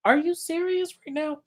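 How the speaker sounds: high, incredulous squeal of disbelief; shocked disbelief. Retold or imitated. Host imitating Lisa's shock at the dinner, high and incredulous. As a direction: play Lisa catching her friend out, pitch way up. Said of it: "Lisa was like".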